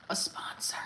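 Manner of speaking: secretive unvoiced whisper. Real, not acted. Hinting to Bustelo about sponsorship, whispered. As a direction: lean in and breathe it like a secret.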